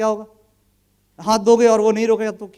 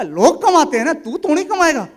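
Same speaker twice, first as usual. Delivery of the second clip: mocking dismissal; 5 semitones higher, 6 dB louder than usual. Mocking the friend's ambition.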